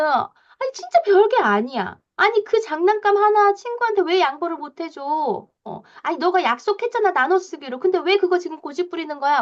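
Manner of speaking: exasperated mom scolding. Retold or imitated. Mom scolding a child over a shared toy, exasperated.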